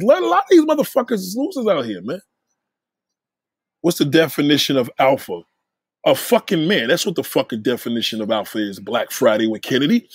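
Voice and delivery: normal talking voice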